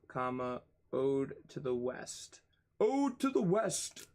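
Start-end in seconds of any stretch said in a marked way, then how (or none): 2.8-4.1 much higher, hammed-up recital; mock-theatrical. Streamer repeats the poetry example, mock-theatrical. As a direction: declaim it high, like a silly poet.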